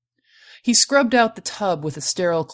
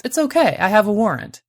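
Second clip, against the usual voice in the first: higher, louder voice for the woman; light reassurance; 5 semitones higher, 5 dB louder than usual. A narrator's reading. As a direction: reassure him, pitched up and lilting. Woman reassures the wary prospector.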